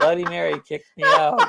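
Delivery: repeated through laughter; laughing. Real, not acted. Reading a viewer's joke, laughing through it. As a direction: laugh the words out.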